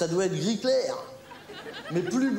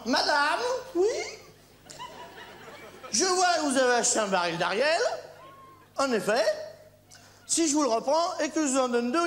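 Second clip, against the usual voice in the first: ad pitchman; 7 semitones higher than usual. Acted. Mock TV ad salesman.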